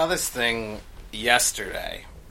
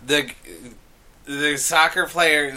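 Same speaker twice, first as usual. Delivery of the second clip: ordinary talk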